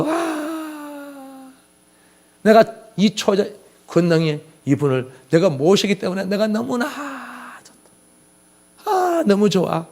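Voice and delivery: hushed, awestruck gush with long airy exhales; acted delight. Believer who has received Christ, acted hushed delight.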